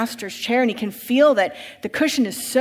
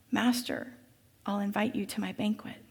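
much softer, humble offer; gentle invitation; 8 dB quieter than usual. Acted. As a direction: invite the master quietly and humbly.

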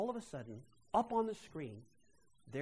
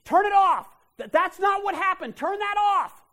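much higher, much louder shouting; panicked yell; 21 dB louder than usual. Retold or imitated. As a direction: scream to make it stop. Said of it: "I stood up and yelled".

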